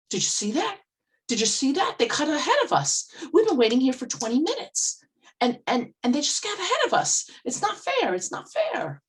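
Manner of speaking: high, loud, indignant complaining; angry stressed woman. Retold or imitated. Stressed woman in the grocery line complaining to the speaker, high indignant outburst. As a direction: retell her outrage at the queue jumpers. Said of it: "she was so angry and so stressed out".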